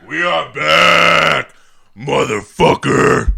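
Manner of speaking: loud, slow, high hype shout; full-voiced show-opening shout. Host announces the show is back, slow loud bellow. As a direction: bellow it out, dragging each word. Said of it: "I don't have my full-blown voice".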